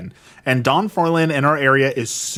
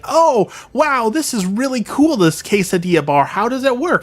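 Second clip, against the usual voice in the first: mocking a clueless customer; 9 semitones higher than usual. Vlogger imitating the restaurant lady, high and ditzy. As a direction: mimic her naive questions in a high voice. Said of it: "she's like".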